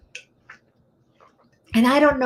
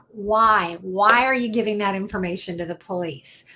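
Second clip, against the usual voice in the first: ordinary talk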